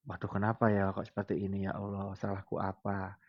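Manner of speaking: soft, pained murmur of self-reproach; quiet lament. Retold or imitated. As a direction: murmur it sadly to God. Introspective lament to God.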